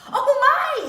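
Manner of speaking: high, loud gasp of dismay; exclaim. A narrator's reading. Rebecca startled by the runaway latkes, loud exclamation. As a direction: cry it out in alarm. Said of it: "exclaimed Rebecca".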